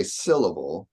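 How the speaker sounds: "syllable" with the first beat SIL stressed. Shown as a demonstration. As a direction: lean on the start of "syllable", let the rest fall light. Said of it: "The sil there is what I'm stressing".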